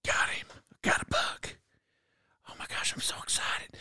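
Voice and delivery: breathless hunter's whisper; excited whisper. Retold or imitated. Hunter whispering to the camera after his shot, thrilled. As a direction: whisper it like you just dropped a buck. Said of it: "I was like".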